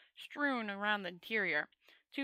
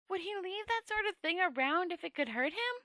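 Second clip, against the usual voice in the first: wondering tourist; 9 semitones higher than usual. A narrator's reading. Two Flower wondering aloud.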